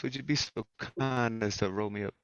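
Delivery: usual speaking voice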